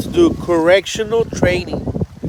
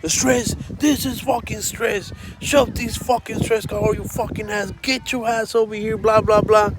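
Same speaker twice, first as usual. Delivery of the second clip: mock angry rant; 5 semitones higher, 4 dB quieter than usual. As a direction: imitate a sergeant losing it, pitched up and held back in volume. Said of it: "First sergeant lost it".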